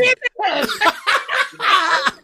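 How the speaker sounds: loud high-pitched laughter; high burst of laughter. Real, not acted. Host cracking up at the video, high loud laughter. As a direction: let a big laugh burst out. After the mirror outburst.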